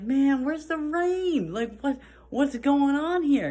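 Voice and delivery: high, drawn-out mock whine; mock bewildered complaint. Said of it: "but in the summertime, we're like".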